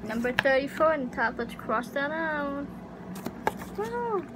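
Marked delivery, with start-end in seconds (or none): none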